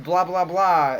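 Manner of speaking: hammed-up scolding of a chattering student; mock teacher scolding. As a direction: play the outraged teacher telling the class off. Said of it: "the teacher flips out".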